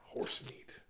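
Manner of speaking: hushed disgusted whisper. Real, not acted. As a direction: whisper it with distaste.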